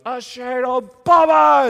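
put-on high, loud, drawn-out kid shout; child's yell. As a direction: yell it like a toddler bursting into the room. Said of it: "she comes running out of the nursery and goes".

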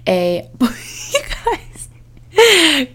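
breaking into laughter; laughing. Real, not acted. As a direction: crack up mid-word. Cracks up mid-list.

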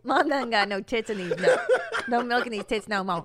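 loud, laughing hillbilly twang. Retold or imitated. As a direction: ham up a backwoods mom. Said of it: "I'd be like".

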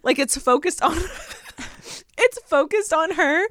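high-pitched, laughing while talking; giggling, voice climbing high; cracking up. Real, not acted. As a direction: try to talk through real laughter. Cracks up describing the video.

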